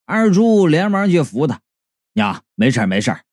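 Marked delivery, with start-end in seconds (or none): 2.1-3.2 reassuring son. A narrator's reading. Son helping his mother, low and reassuring. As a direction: soothe her in a low voice.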